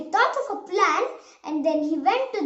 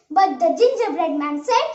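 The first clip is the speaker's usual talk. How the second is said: ordinary delivery, nothing marked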